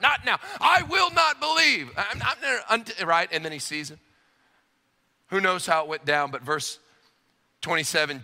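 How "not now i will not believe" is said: pontificating. Acted. Thomas tapped on the shoulder mid-speech, pontificating. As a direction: brush off the interruption and proclaim it grandly, pitched way up. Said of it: "sort of pontificating to the disciples".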